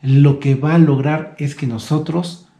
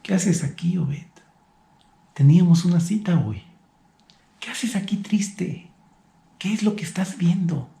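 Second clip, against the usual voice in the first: gentle voice of God; 4 semitones higher, 5 dB quieter than usual. Retold or imitated. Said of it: "ha llegado a mi mente Dios con estas preguntas".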